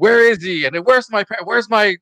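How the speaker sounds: hammed-up angry demand. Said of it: "What's his angry voice?".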